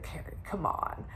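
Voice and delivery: low, hushed mock brush-off; dismissive, no big deal. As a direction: play it down under your breath. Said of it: "like it's, like, no big deal".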